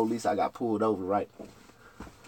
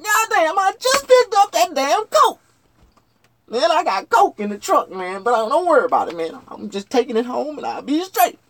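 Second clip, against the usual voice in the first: frantic explaining; 14 semitones higher, 8 dB louder than usual. Retold or imitated. Explaining to police.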